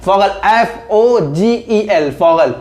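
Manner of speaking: slow, letter by letter; slow spelling. Shown as a demonstration. Teacher spells the German word so students can write it. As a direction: spell it out slowly for the notes. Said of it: "इसको हम लिखते हैं फॉगल".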